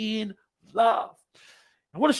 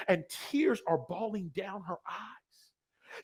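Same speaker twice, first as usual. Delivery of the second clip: ordinary delivery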